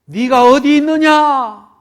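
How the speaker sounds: high, loud, slow call; God calling out. Acted. Preacher voicing God calling Adam, a loud slow call. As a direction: call out across the garden.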